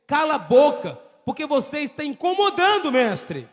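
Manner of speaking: loud sharp rebuke; crowd silencing. Retold or imitated. Crowd loudly rebuking the blind man to stop shouting. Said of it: "falou com Jesus assim".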